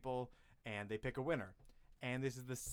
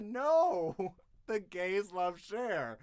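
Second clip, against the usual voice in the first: exasperated retort; 5 semitones higher, 8 dB louder, much slower than usual. Retold or imitated. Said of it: "I wanna say to them".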